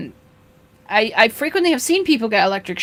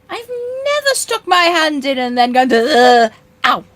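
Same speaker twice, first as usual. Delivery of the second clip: mock pained ugh; 6 semitones higher, 6 dB louder, slower than usual. Mock reaction to a shock.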